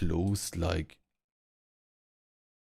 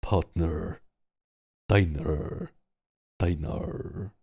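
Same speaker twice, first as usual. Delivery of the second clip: mock American accent; much slower than usual. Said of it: "It sounds like a dog".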